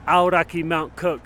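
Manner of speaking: slow, high mock newsreader; news anchor. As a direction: announce it like breaking news. Mock news read over music.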